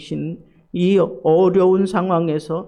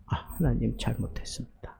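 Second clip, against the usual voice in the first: 회개의 속삭임; 6 semitones lower, 11 dB quieter, faster than usual. Retold or imitated. Repentant prayer after realizing.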